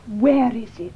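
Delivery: urgent hushed whisper. Acted. Pressing John about the lost diary, whispering. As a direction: drop to a tense whisper.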